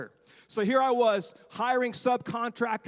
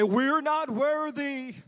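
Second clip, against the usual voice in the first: mock worship; 6 semitones higher, 5 dB louder, much slower than usual. Shown as a demonstration. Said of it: "Everybody say".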